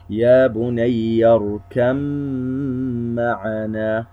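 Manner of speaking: slow recitation with the nasal hum held on the doubled meem; ghunnah held two counts. Shown as a demonstration. A teacher reciting slowly, holding the ghunnah. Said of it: "وهو موضع وحيد في سورة هود".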